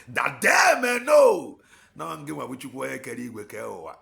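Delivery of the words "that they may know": loud, high and slow cry to God; shouted prayer. Real, not acted. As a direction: let it ring out to the rafters.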